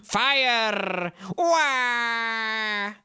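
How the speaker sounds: loud, raspy cartoon yell; Wario shout. Streamer tries Wario for chat, shouting. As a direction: bellow like a brute. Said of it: "I mean, I can try".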